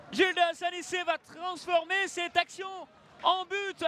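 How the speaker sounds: ordinary talk, nothing marked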